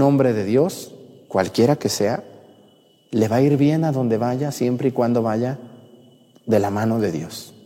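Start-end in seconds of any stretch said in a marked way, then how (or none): none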